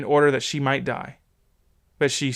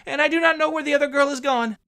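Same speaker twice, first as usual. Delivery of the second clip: put-on much higher girl's voice; trembling servant girl; 13 semitones higher, faster than usual. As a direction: answer shakily, beside herself. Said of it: "who was trembling and beside herself".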